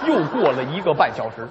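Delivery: ordinary talk, nothing marked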